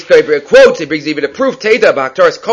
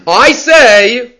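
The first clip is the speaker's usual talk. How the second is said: high, loud proclamation; mock self-assertion; 6 semitones higher, 5 dB louder than usual. Teacher acting out a Tana's unusual phrasing, mock proclamation. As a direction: declare it grandly, pitched up and loud.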